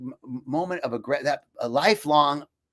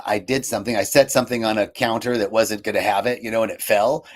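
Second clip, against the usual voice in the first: normal talking voice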